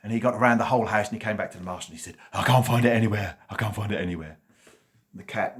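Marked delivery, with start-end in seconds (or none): 2.3-4.4 excited dog. A narrator's reading. Dog reports to master.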